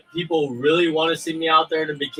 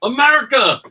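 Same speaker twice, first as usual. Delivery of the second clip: patriotic shout; 9 semitones higher, 5 dB louder than usual. Acted. Patriotic cheer.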